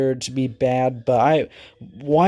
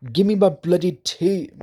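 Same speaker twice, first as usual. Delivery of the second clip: higher-pitched put-on British accent; a playful fake British voice, pitched up; mock British accent; 6 semitones higher than usual. Vlogger mocking how British people swear, in a high British accent. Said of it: "I need a British accent".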